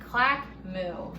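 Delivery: plain reading voice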